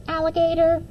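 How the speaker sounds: high, playful group call; second graders answering the principal; children calling out. A narrator's reading. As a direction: answer like a class of kids. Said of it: "the second graders called out".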